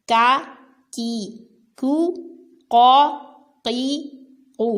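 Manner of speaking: کا کی کو thin, قو قی قو heavy from the back; teacher drilling کاف and قاف; kaf and qaf drill. Shown as a demonstration. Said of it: "کاف اور قاف میں بھی فرق ہے".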